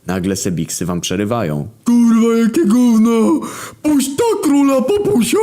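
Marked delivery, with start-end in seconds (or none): 1.8-5.4 put-on very high, loud, strained drunk shriek; furious drunk guest screaming at the band; drunk thug yelling. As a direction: screech it like a drunk wedding brawler. Drunk wedding thugs yelling.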